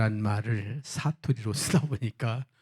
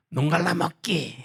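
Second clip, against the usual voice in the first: Gyeongsang grandma; 6 semitones higher than usual. Acted. Gyeongsang grandma answers in game.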